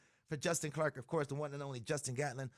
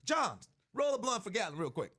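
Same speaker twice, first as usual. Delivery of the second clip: bossy call-out; 7 semitones higher, 5 dB louder than usual. Pretend. Imagined [name] ordering an assistant.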